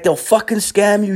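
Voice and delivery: high-pitched imitated street dealer; urgent high hustler impression; beach hustler. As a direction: warn them off in a high put-on voice. Beach dealer warning about rivals.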